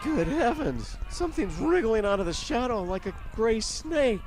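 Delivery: a reporter losing his nerve on air; mounting panic. Acted. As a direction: shaken announcer pushing on as terror builds. Reporter sees the creature emerge.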